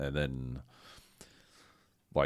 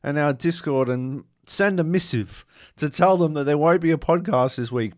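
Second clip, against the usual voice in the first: much higher mock-heroic vow to reach the listeners; hammed-up dying hero; 9 semitones higher, 6 dB louder than usual. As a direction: deliver it like a last duty. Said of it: "I'm like".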